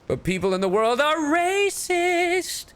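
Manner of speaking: high, theatrical complaint; exasperated wail. Real, not acted. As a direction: climb to a high drawn-out cry. Mock lament about the movie's reception.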